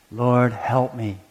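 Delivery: soft, slow, pleading; a hint of a desperate mother begging; quiet desperate plea. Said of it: "She was desperate".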